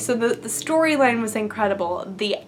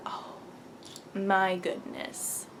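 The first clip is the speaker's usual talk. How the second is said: hushed awe; 4 semitones lower, 13 dB quieter, slower than usual. Real, not acted. Gushing about a movie.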